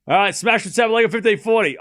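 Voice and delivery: loud, high commentator call; tennis play-by-play. Acted. Streamer commentating a smash for chat, loud and high.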